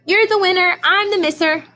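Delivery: sing-song playful race taunt. Retold or imitated. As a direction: tease the winner playfully.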